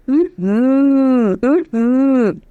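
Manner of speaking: a savouring mm-hmm, as if tasting chocolate cake; mm-hmm hum. Shown as a demonstration. Coach demonstrating the pitch hum, savouring mm-hmm. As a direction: hum mm-hmm like something delicious. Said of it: "Just go, mm-hmm".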